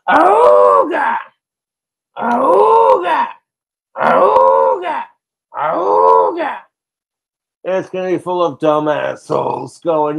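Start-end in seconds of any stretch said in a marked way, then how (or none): 0.1-6.6 honking "Auga!" over and over, mostly much higher and louder; repeated car horn honks. Retold or imitated. Streamer mimicking his phone blowing up, repeated horn honks. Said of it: "That Model T horn ringtone".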